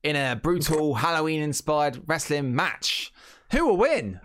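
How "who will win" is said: high, punchy hype; hyped announcer question. Acted. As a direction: sell the question to the crowd. Hyping the match question.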